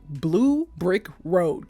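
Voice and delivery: slow, separated BLUE BRICK ROAD; careful articulation. Real, not acted. Retrying a tongue twister, carefully slowed. Can't even say that.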